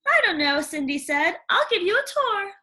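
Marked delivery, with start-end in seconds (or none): 0.1-0.6 put-on high, bright chatterbox girl; gushing young girl, rising as she goes; eager Cindy telling about animals. Cindy voiced in story. 1.5-2.5 put-on high, bright chatterbox girl; gushing young girl, rising as she goes; eager Cindy telling about animals. Cindy voiced in story.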